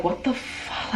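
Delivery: breathless whispered curse; a shocked whisper to yourself; whispered disbelief. Retold or imitated. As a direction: hiss it under your breath, stunned. Reacting to bank's fraud news.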